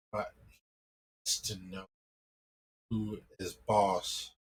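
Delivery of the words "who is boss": usual speaking voice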